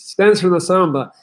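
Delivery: ordinary talk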